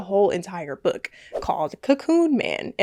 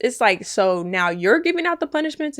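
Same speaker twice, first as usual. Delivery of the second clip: usual speaking voice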